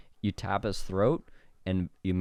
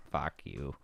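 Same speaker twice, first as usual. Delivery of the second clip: voicing the dog; 7 semitones lower, 11 dB quieter than usual. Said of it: "He's like".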